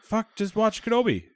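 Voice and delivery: high, drawn-out mock exasperation; retold exasperated urging. Retelling friend telling him to just watch it, exasperated.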